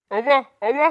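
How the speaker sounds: calling out. Acted. Son calls after mother.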